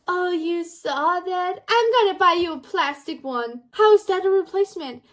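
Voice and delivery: high-pitched, exaggerated imitation of her; mocking the sister. Reteller mocks sister's unapologetic reply.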